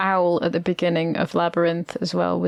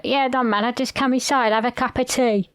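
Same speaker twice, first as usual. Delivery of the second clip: Cockney worm; 4 semitones higher than usual. Retold or imitated. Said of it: "he's like".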